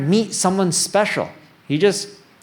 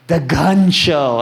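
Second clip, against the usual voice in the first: mock proud boast; 4 semitones lower, 3 dB louder, much slower than usual. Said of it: "I'm like".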